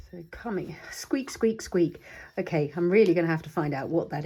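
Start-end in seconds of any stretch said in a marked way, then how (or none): 0.9-2.0 whispered squeak squeak; squeak imitation. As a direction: mimic a squeaky bottle.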